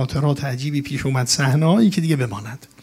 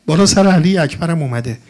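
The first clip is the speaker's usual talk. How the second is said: usual speaking voice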